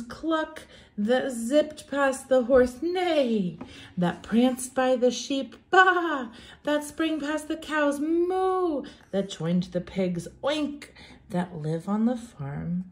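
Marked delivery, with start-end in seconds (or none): 0.0-0.6 mock hen cluck. Animal sound in rhyme. 2.8-3.6 high playful neigh; whinny like a horse; a child's play horse neigh; mock horse neigh. Animal sound in rhyme. 5.7-6.3 high bleating baa; a playful higher baa; mock sheep baa. Animal sound in rhyme. 8.0-8.8 high playful moo; a sing-song moo for kids; mock cow moo. Animal sound in rhyme. 10.4-10.9 high playful oink; a cheeky oink for kids; mock pig oink. Animal sound in rhyme.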